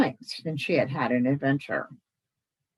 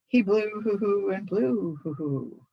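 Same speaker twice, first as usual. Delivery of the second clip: stuttering hoots; 3 semitones higher than usual. Acted. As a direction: let hoots break into the words. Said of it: "How you stutter".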